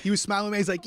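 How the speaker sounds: usual speaking voice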